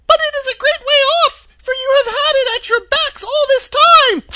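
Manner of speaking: very high, loud squeaky little voice; tiny squeaky royal mouse, quick and piercingly high; Queen of the Mice. A narrator's reading. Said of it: "in her squeaky little voice".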